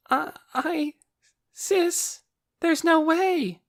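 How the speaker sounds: put-on high, slow, timid boy voice; weak little voice. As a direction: stammer like a frightened child. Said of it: "A weak little voice replied".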